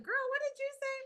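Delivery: high mock-indignant squeal; mock outrage. Mom imagining answering her daughter in high mock outrage. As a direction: pretend to be scandalized, voice way up. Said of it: "I wanted to be like".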